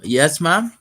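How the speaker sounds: calling back. Real, not acted. Answering a call from the next room.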